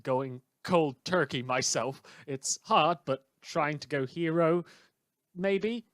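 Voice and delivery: ordinary delivery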